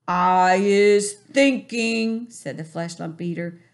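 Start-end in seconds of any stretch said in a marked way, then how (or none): 0.1-2.3 loud, slow, menacing giant voice; booming giant. A narrator's reading. Bully giant mocking the BFG, loud and slow. Flesh lump eater voiced.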